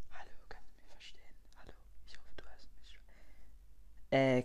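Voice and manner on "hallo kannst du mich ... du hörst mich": barely audible whisper; viel zu leise. Acted. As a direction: whisper into the phone so softly it hardly carries. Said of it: "Der, der immer viel zu leise spricht". (kaum hörbar geflüstert)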